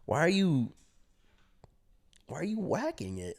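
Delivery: high-pitched puzzled question; halting, baffled questioning; confused. Retold or imitated. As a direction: imitate naive bewilderment. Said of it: "Bro, I'm confused".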